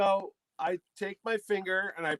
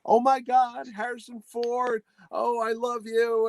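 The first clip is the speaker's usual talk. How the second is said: higher-pitched gushing fan; mock freak-out; 6 semitones higher than usual. Excited woman meets Harrison Ford, mock freak-out. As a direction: imitate a starstruck woman losing it. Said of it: "she's freaking out".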